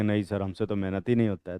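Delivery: teacher mocking a lazy student's excuse, quiet whine. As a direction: whine like a lazy student who won't work. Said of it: "उसमें सब ऐसे हैं".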